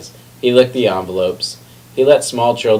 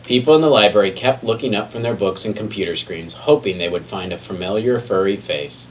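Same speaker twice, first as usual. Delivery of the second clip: usual speaking voice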